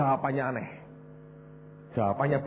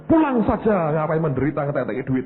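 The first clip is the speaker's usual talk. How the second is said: high, breezy mock pastor; mock pastor advice; 6 semitones higher, 3 dB louder than usual. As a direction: toss it off like a careless pastor. (pendeta menyuruh orang sakit pulang, nada tinggi mengejek)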